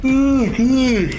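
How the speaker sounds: mid-yawn groan, pitch jumping up. Real, not acted. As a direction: stretch it out through a yawn. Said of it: "Why am I yawning?".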